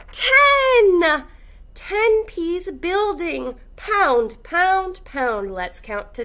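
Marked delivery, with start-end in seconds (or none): 0.1-1.2 sharp gasp then a high, loud "Ten"; mock surprise. Teacher revealing the answer to kindergartners, mock surprised. 1.7-5.5 lively, sing-song picture book read; reading to children. Acted. Teacher reading a counting book to kindergartners, animated.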